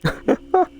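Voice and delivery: a sudden high cackle of real amusement; high-pitched laugh breaking out; burst of laughter. Real, not acted. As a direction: crack up at the punchline. Laughing at a joke he read.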